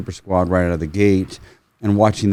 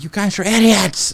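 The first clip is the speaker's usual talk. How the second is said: high, exasperated jab at the guys; mock outraged; 12 semitones higher, 3 dB louder than usual. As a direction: throw it out in disbelief. Complaining about recoil.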